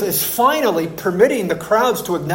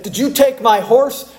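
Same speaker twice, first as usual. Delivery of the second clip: cowboy accusing; 7 semitones higher than usual. Retold or imitated. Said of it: "he looked at my dad and he said".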